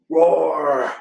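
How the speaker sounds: hammed-up bear roar; mock roar. Bruce losing his cool, mock bear roar. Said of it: "Bruce started to lose his cool".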